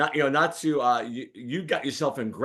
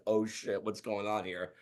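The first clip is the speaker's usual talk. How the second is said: embarrassed mutter; 3 semitones lower, 9 dB quieter than usual. Retold or imitated.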